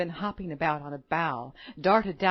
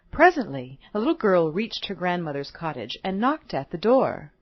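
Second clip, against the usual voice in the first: usual speaking voice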